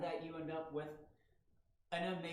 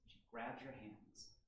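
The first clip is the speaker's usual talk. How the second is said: ordinary talk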